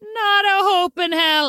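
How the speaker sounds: high, slow mocking refusal; mocking bloke. Jono scoffing at the fake ID, high and slow. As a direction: drag it out as a scornful lad. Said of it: "And Jono goes".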